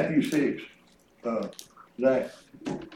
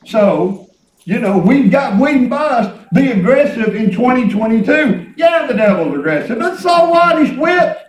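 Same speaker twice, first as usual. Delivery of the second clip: loud, driving sermon delivery; fervent preaching; 8 semitones higher, 6 dB louder than usual. Real, not acted. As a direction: preach it out with punched phrase endings. Preaches with full voice.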